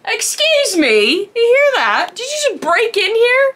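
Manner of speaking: high, loud indignant scolding; mock outrage. Scolding her sneaky pet, high and loud in mock outrage. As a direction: call out the intruder, scandalised.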